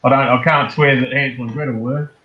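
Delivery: ordinary delivery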